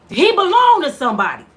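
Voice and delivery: loud, furious insistence. Real, not acted. Mother defending her jailed son, furious and loud. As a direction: she throws the words out in real anger.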